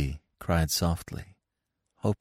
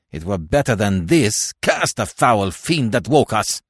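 loud, high, bitter outcry; harsh railing; 7 semitones higher, 8 dB louder than usual. A narrator's reading. Dead man curses the fiend that woke him, loud and harsh. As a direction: protest at full voice, cursing harshly. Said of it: "cried Francois harshly".

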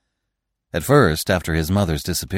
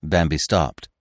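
ordinary delivery, nothing marked

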